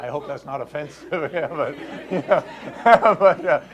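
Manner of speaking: talking through real laughter; laughing. Real, not acted. Caller joke aside, laughing through the words.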